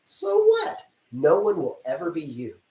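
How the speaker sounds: normal talking voice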